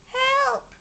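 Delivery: high, loud yelp while skidding; cry for help. A narrator's reading. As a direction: yell as he slides down the hill. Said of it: "he cried".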